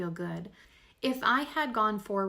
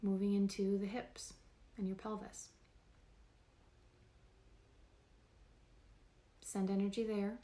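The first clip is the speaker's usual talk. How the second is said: guided meditation; 5 dB quieter than usual. Real, not acted. Host guiding listeners through a body scan, calm meditation voice. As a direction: talk them gently through the body, unhurried.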